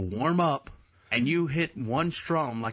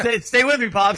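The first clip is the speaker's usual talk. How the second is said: firm, loud call to attention; 8 semitones higher, 9 dB louder than usual. Pretend. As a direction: snap him back to attention. Mock scolding a listener.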